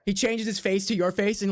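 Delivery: ordinary talk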